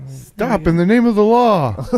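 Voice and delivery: high, loud, drawn-out command; lawman's shout. Acted. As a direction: bellow it like a cartoon cop.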